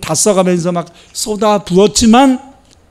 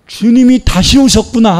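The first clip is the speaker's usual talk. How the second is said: higher and brighter, eager cry; excited exclamation; 6 semitones higher, 5 dB louder than usual. Acted. Peter seeing the Lord again, excited cry. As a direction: burst out with joy.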